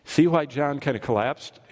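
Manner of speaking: ordinary talk, nothing marked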